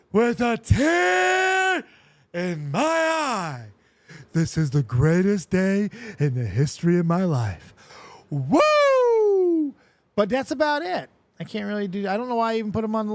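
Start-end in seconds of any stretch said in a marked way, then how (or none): 0.1-9.7 Ric Flair. Acted. Podcast host shows off his one wrestler phrase, loud drawn-out promo impression. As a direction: stretch each phrase big and slow, then howl. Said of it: "one phrase as Ric Flair".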